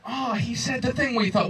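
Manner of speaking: high mock-pitying coo; mocking the aw again. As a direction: parody the crowd's sympathy, high. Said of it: "What did we just say about awe?".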